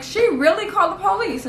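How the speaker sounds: high-pitched incredulous question; retold indignant surprise; acted disbelief. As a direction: ask it in mock outrage, voice jumping up. Acts out disbelief at police call.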